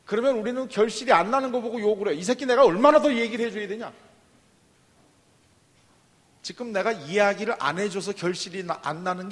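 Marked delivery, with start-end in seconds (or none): 2.1-3.9 put-on louder, fed-up parent snapping at a child; mock angry parent yelling; exasperated scolding. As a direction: scold like a parent at the end of their rope.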